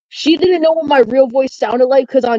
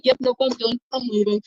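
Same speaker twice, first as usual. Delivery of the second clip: quitting in disbelief; 7 dB quieter than usual. Retold or imitated. Friend bailing, softer.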